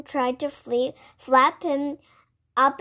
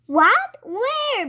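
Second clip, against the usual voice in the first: higher, rising storybook surprise; startled questions; 9 semitones higher, 4 dB louder than usual. A narrator's reading. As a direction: ask it suddenly, pitch jumping up. Stanley puzzled as swarm swerves.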